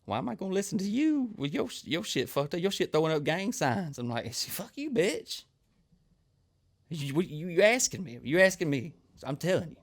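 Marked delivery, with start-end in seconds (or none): 0.0-4.0 high-pitched, incredulous mock outrage; high, scornful retort in a put-on voice; indignant coworker. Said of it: "And she's like". 4.3-9.8 high-pitched, stammering mock indignation; hammed-up offended retort; indignant comeback. Acted comeback in retold quarrel.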